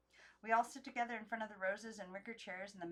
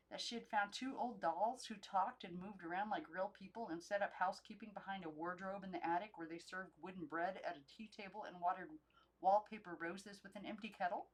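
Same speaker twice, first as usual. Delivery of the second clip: usual speaking voice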